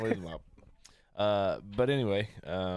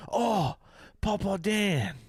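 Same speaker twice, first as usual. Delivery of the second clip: put-on much higher and louder, delighted little kid voice; imitating a toddler; 12 semitones higher, 7 dB louder, much slower than usual. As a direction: squeal it like a toddler spotting grandpa. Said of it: "he goes".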